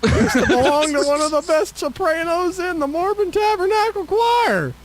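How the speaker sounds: animated folksy Southern complaint; Southern accent. Acted. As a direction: put on a Southern twang, climbing toward the end. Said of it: "we're back to Southern".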